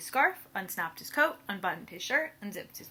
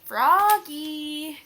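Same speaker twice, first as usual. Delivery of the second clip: long, loud high call across the yard; 4 semitones higher, 9 dB louder than usual. Acted. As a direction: call him from far away. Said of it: "called his mother".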